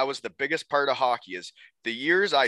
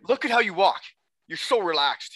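imitating a coach's higher-pitched ribbing; mocking coach; 6 semitones higher than usual. Coach making fun of a player's walk, mocking. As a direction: needle him about his walk, pitched up. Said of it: "him making fun of me".

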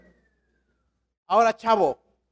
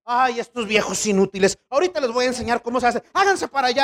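mocking Jezebel; 6 semitones higher than usual. Jezebel mocked by preacher.

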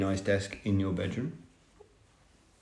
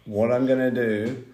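normal talking voice

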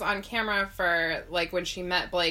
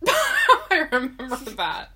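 laughing; 5 semitones higher, 8 dB louder, slower than usual. Real, not acted. Laughing while talking.

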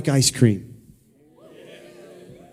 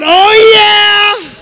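much higher, loud oh yeah; shouted oh yeah; 4 dB louder than usual. Shown as a demonstration. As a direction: belt it out for the crowd.

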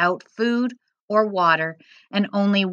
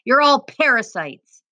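higher-pitched acted yell of abuse; yelling guard; 6 semitones higher, 4 dB louder than usual. A narrator's reading. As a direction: shout it down at prisoners as a guard. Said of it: "the guard yelled in Arabic".

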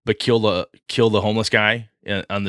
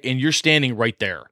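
ordinary talk, nothing marked

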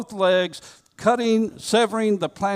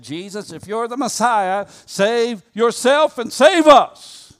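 railing taunt; 6 semitones higher, 6 dB louder than usual. Acted. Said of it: "railed against him".

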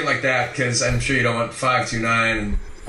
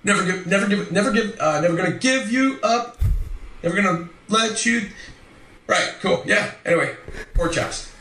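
high, halting character voice botching the catchphrase; Mirage; 6 semitones higher than usual. Acted. Retrying the requested line after a prompt, high and stammering in character. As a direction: keep restarting, then brush it off brightly. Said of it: "you said to say it as Mirage".

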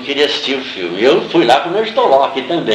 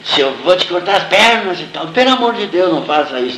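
retold desperate plea; 6 semitones higher than usual. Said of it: "E gritando de lá".

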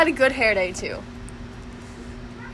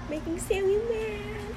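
high, soft teasing murmur; singsong tease; 9 semitones higher, 12 dB quieter, slower than usual. Real, not acted. Friend teasing quietly, higher and slower. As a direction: sing it under your breath to needle her.

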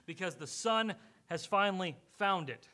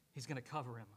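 usual speaking voice

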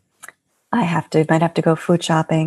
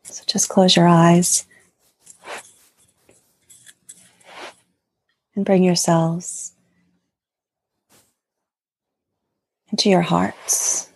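guided meditation. Acted. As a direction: gently guide them into their hearts. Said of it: "would you like to, to lead us in something".

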